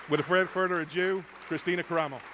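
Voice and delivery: announcer intro. Real, not acted. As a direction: boom out her name to the room. Host announces the chairwoman.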